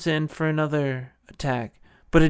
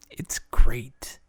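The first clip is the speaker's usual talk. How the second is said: breathy whisper to the audience; whispered aside; 3 semitones lower, 4 dB louder than usual. Real, not acted. Host gushes to viewers in a hushed whisper. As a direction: lean in and whisper it.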